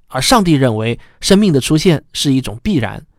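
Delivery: usual speaking voice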